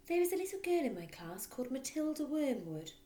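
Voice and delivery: put-on timid young teacher speaking up; gentle teacher Miss Honey. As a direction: raise the voice a little and keep it polite. Miss Honey voiced in reading.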